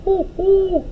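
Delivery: hoo like an owl; owl hoots. Acted. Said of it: "She imitated an owl".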